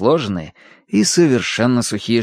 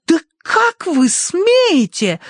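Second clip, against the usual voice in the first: high, drawn-out outrage; Tossie; much slower than usual. A narrator's reading. Tossie flaring up at the critical butler, outraged. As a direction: flare up in squeaky indignation. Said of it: "вспыхнула Тосси".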